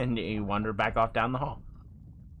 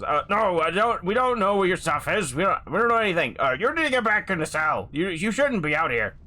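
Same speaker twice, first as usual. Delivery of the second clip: higher, hurried orc stammering; flustered orc guard; 5 semitones higher than usual. Acted. Orc guard answers escaped prisoner, flustered. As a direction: deny everything, then nervously send him back.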